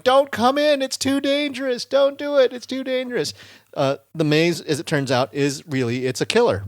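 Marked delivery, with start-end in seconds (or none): 0.0-3.3 very high, mock-panicked plea; imitated frantic warning. Trapped Dave warns his friends away, high mock panic. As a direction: plead with them to stay out, voice pitched right up. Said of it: "despite Dave going".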